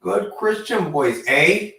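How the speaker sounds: slow, drawn-out Canadian eh; mock Canadian accent. Mocking the Young Bucks line in a Canadian accent. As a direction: tease it with a Canadian twang. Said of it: "add an A at the end of that shit".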